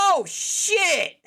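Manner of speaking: loud, high gasp into "Oh, shit!"; gasping in shock at finding the bleeding friend. Acted. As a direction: recoil in horror and swear. Finds the stabbed friend.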